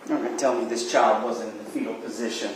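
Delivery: ordinary talk, nothing marked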